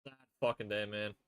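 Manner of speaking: low, soft and deflated; dejected. Real, not acted. Fan after his team lost, low and dejected. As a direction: let it drop quietly in defeat.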